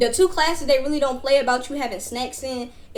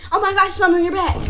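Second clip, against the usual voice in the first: put-on high, fast kid's taunt; mock excited prank call; 6 semitones higher, 4 dB louder, much faster than usual. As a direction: blurt it out high and quick. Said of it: "somebody else saying it".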